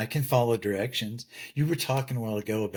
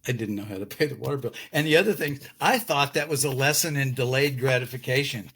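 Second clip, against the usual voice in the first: normal talking voice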